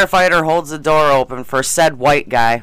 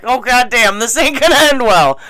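higher, louder retold alarm; retold alarmed reaction; 8 semitones higher, 4 dB louder than usual. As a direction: replay your own rising alarm. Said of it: "and I'm like".

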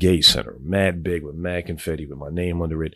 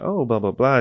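put-on girl voice; 8 semitones higher than usual. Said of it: "that's my girl voice".

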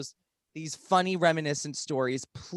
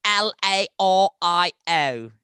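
slow spelling; 3 semitones higher, 9 dB louder, slower than usual. Acted. Slowly spelling out Instagram handle.

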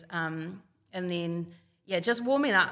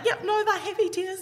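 very high, louder reenacted reassurance; retold tearful reassurance; 13 semitones higher, 5 dB louder than usual. Crying daughter reassuring worried mum, high retold reassurance. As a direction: pitch it right up to reassure, lightly acted.

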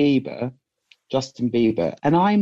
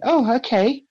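taken aback; 10 semitones higher, slower than usual. Retold or imitated. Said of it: "And I went".

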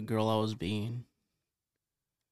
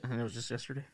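quiet question; 2 semitones lower, 8 dB quieter, faster than usual. Real, not acted. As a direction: ask it softly, almost to yourself. First asking, not heard by listener.

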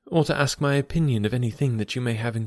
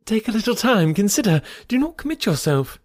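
high, urgent pleading; eager plea; 11 semitones higher than usual. Acted. Emma urging Knightley to wait, high eager plea. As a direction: hold him back, kindly but anxious. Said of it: "she eagerly cried".

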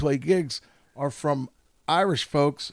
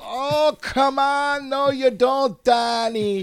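bad Irish accent; 9 semitones higher, slower than usual. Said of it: "You know, Danny".

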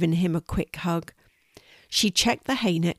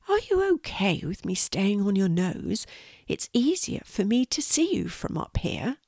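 little spider voice; 2 semitones higher than usual. Acted. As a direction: ask shyly in a tiny creature voice. Spider asks the horse.

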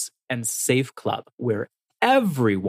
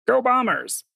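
higher, hammed-up sports chant; mock footy cheer; 12 semitones higher than usual. Co-host cheering for the Essendon team, hammed-up and high. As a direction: cheer the Bombers like a diehard fan. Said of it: "That's not me".

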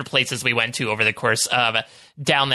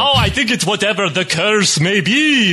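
Count Jacula vampire voice. Acted. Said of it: "Count Jacula".

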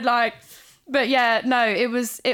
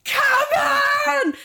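retold celebratory shout. Partner celebrating the baby girl, roaring like at the World Cup. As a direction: cheer like your team just scored. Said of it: "jumping around the room shouting".

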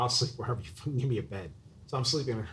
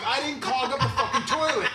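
high, laughing heated protest; half-laughing indignant retort; mock angry denial; 9 semitones higher, 3 dB louder than usual. Guest arguing with host over toilet, laughing indignant protest.

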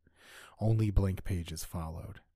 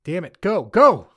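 much louder and higher, yelling go; urgent shout; 11 semitones higher, 16 dB louder than usual. Acted. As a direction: scream the order to run. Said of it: "I ordered Lori".

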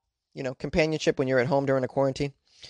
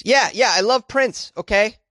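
higher, drawn-out mock-defensive protest; defensive insistence; 7 semitones higher, 3 dB louder than usual. Host answers doubter about [name], playfully defensive. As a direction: insist as if challenged.

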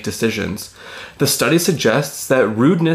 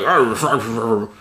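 put-on old man voice. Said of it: "That's my old man voice".

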